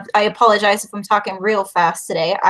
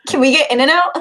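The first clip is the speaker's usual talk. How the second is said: louder repeat; 3 semitones higher, 4 dB louder than usual. Real, not acted. Repeating request to host who missed it, louder. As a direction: say it again a bit louder.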